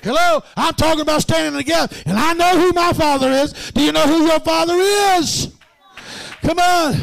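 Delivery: high, loud preacher's shout building; full-force preaching. Acted. Preacher rousing the saints, loud and high. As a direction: preach it out with rising fire.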